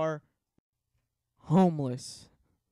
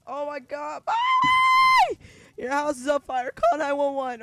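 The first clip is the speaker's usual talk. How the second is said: high, loud frantic yelling at her; shrieking for help as the house burns; panicked yell; 9 semitones higher, 6 dB louder than usual. Pretend. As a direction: scream at her to save herself.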